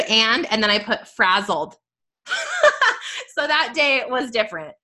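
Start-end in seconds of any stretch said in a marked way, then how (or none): 2.3-3.3 high-pitched burst of laughter; real laugh. Real, not acted. Speaker amused at her own journal entry saying frazzled, real laugh.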